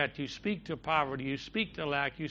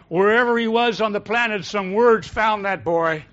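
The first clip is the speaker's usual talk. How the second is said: preaching climax; 4 semitones higher, 9 dB louder than usual. Real, not acted.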